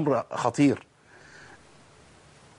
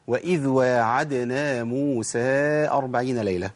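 slow measured tajweed reading; Quran recitation; 2 semitones lower, much slower than usual. Shown as a demonstration. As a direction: recite the verse slowly. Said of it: "قوله تعالى".

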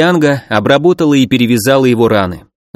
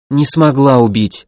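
ordinary delivery, nothing marked

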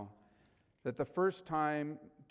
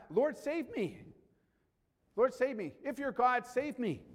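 higher, urgent cries of Lord save me; desperate pleading prayer; 8 semitones higher, 4 dB louder than usual. Retold or imitated. A believer in a jam begging God, pleading. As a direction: beg God to rescue you from the jam. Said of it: "We pray out to God".

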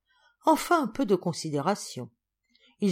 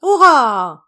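cheer; 6 semitones higher, 14 dB louder than usual. Acted. Captain rejoicing.

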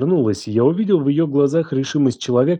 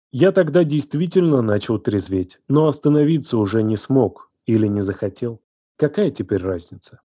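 ordinary delivery

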